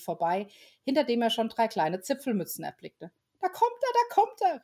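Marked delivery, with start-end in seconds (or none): 3.4-4.6 put-on high, bright child voices calling out; high excited elf children. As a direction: cry it out like eager little kids. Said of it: "riefen die Wichtelkinder".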